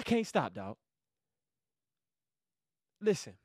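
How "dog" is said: ordinary talk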